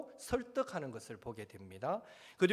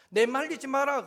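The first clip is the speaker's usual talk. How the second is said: God's stern command; 7 semitones higher, 8 dB louder than usual. Retold or imitated. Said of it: "그랬을 때".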